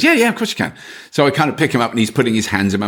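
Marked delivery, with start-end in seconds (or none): 0.0-0.7 high, light yes; easy permission from the mum; breezy mum. Retold or imitated. Mum letting him cuddle her baby, breezy high. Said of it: "And the mum says".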